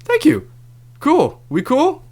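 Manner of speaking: very high, slow, sugary thank you; mock sweet relief. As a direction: play it falsely sweet after the fight. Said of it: "I'm like".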